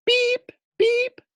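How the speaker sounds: very high beeping horn. Acted. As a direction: honk like a toy fire truck. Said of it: "He honked the horn".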